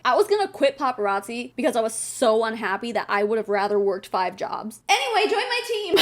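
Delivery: flat recap then a squeaky laughing pivot; mock him cheerfully switching to a sales pitch; mocking imitation. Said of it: "He's basically like".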